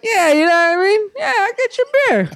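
high strained eager voice; fake cheerful agreement. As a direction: force the smile into the words. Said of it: "I'm over here like".

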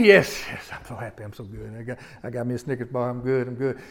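low, soft, gleeful muttering; happy child. Acted. Child clutching candy in checkout line, quietly pleased. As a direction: gloat quietly to yourself over the prize. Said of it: "and they're happy".

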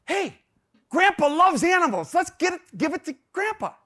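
put-on high, bright-idea child voice; excited kids. Sons suggesting Grandpa gets the turtle, eager. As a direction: pipe up like a kid hatching a plan. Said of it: "they think".

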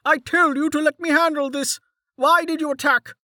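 scolding mayor. A narrator's reading. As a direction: berate them like naughty children.

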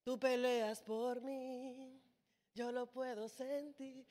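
hushed, slow imitation of a pleading worshipper; softly mocking a passive plea. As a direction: whisper it like a dreamy prayer.

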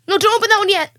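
high, loud urgent protest; alarmed shout. Real, not acted. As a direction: stop him fast and loud. Friend opening wrong soda.

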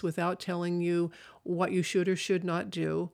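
usual speaking voice

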